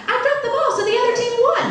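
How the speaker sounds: put-on high-pitched little boy confession; young son. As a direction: own up in a high kid voice. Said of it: "his son arrives".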